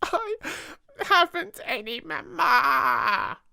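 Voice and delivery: very high, slow tearful wail; wailing proclamation. A narrator's reading. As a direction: announce it through tears. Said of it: "she proclaimed".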